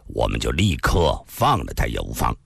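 缓缓地说; slowly. Acted. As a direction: take your time, weigh each word. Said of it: "易明湖缓缓地说道".

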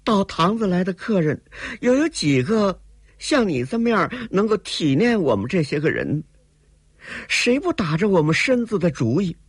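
put-on high, plaintive young woman pleading her lot; pitiful. A narrator's reading. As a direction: make him pity you. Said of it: "怪可怜地说".

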